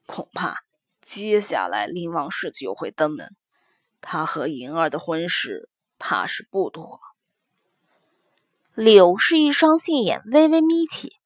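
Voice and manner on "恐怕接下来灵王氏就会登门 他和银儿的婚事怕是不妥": put-on lower, softer older man speaking; a weary official father confiding; middle-aged man voice. Son continues.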